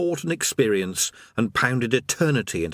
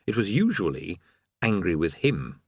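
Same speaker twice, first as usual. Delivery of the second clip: ordinary delivery, nothing marked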